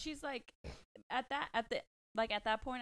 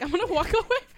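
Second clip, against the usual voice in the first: loud mock exit; 11 semitones higher, 16 dB louder, faster than usual. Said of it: "Noah's like".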